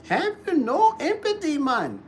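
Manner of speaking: high-pitched mock Jamaican plea; hammed-up Jamaican scolding; imitated Jamaican accent. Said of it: "Jamaican accent on Colbert".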